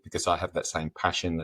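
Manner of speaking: ordinary talk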